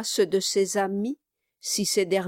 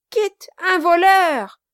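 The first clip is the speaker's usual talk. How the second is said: even higher, louder, jeering shout; gleeful shout; 11 semitones higher, 10 dB louder than usual. Acted. Quilp shouts over Kit's arrest, gleeful. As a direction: crow it to the whole street. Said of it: "s'écria le nain".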